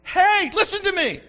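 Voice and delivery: God urging Gideon; God calling out. Acted. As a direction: call him out like God. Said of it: "God says".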